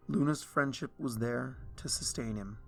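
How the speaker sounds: usual speaking voice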